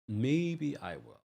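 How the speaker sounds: slow, soft teasing maybe; coy tease. Pretend. Host teasing chat about a recap, slow coy maybe. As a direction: dangle it playfully for the fans.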